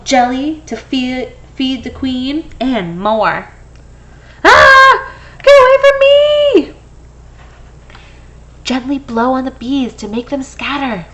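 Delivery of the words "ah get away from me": high, loud frightened shriek; panicked scream. Acted. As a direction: yell at the bees to go away. Bees swarm the character.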